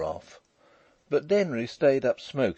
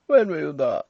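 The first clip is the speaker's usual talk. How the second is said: high, loud, overbearing voice; loud, high-pitched drawler; drawling toff; 7 semitones higher, 8 dB louder than usual. A narrator's reading. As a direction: question him like a lordly toff. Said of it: "drawling, overbearing fellow with an eyeglass".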